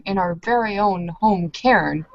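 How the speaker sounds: usual speaking voice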